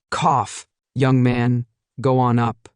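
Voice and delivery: usual speaking voice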